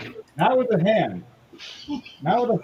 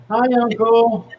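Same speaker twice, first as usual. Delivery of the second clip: nephew's greeting; 6 semitones higher, 5 dB louder than usual. Acted. Captured nephew calling out to uncle.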